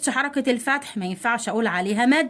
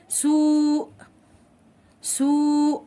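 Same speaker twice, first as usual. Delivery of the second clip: higher, long held vowel; drawn-out model word; 4 semitones higher, much slower than usual. Shown as a demonstration. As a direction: stretch the word out for pupils. Teacher models example word twice. (نطق ممدود للكلمة)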